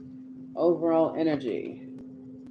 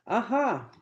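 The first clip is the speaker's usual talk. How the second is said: delighted discovery; 8 semitones higher than usual. Real, not acted. Spots a card, exclaims.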